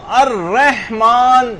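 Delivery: الرحمن stretched as "ar-reh-maan", a y sliding into the vowel; wrong added glide. Shown as a demonstration. Teacher warns students against this, exaggerated mispronunciation. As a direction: drag out الرحمن with a narrowed "reh". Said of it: "یوں نہ کریں".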